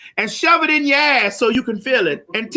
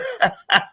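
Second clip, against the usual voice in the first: laugh; 9 semitones higher than usual. Real, not acted. Laughing at own bit.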